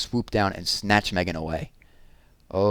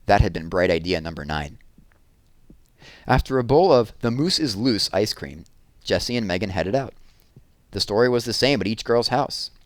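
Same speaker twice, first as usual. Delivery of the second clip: plain reading voice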